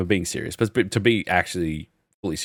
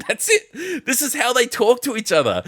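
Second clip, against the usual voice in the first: high-pitched, breaking up with laughter; laughing in disbelief. Real, not acted. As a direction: laugh your way through the explanation. Cracking up at kids' chat.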